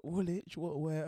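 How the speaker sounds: lightly acted startled questions; imitated shocked reaction. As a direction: act out a taken-aback listener. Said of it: "they're all terrified".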